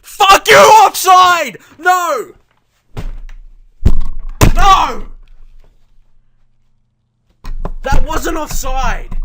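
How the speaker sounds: high, very loud enraged yelling; furious shout. Real, not acted. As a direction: scream at the offside call. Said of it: "I am mad".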